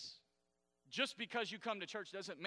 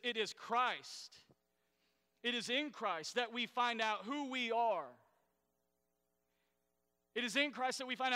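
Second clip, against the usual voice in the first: emphatic scripture reading; 5 semitones higher, 5 dB louder than usual. Acted. Pastor reads the Message version to the church, building. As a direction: lean into every phrase.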